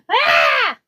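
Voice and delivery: sudden high yelp of alarm; shout of realization. Real, not acted. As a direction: let out a high startled cry. Realizes she mixed up days.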